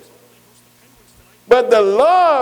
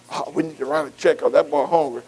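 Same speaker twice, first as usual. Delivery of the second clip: mock excuse; 7 semitones lower, 6 dB quieter than usual. Mocking church members' excuse.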